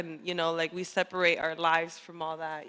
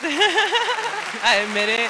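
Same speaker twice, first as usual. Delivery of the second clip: laughing; 7 semitones higher, 7 dB louder, much slower than usual. Real, not acted. Confessing amid laughter.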